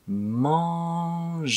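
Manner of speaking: Manger drawn out, the nasal held long; slow demo of Manger. Shown as a demonstration. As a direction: stretch Manger slowly. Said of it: "とってもとってもゆっくり".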